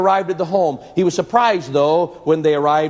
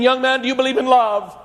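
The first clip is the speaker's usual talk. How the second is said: high, raised shouting voice; shouted question; 7 semitones higher than usual. A narrator's reading. Preacher shouting at the drunk about love, high raised voice. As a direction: fling the question at him. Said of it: "the preacher shouted at him again".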